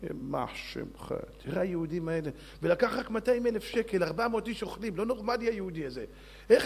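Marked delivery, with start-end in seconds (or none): 0.0-1.1 slow breathy whisper, much softer; whispered curse. Acted. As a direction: hiss it under your breath. Minister curses under breath.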